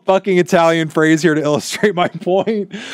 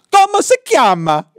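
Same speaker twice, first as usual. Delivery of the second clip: high, loud cartoonish Italian accent; exaggerated Italian accent; 11 semitones higher, 4 dB louder than usual. Shown as a demonstration. Mocking a cartoonish Italian accent to his friend. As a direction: ham up a clownish Italian accent to mock it. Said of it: "and saying".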